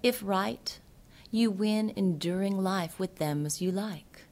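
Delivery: ordinary delivery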